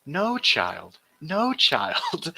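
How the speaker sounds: a hint of a simpering scold; mocking his voice. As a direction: imitate his moist, mincing tone with a light touch. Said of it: "the moisture in his voice".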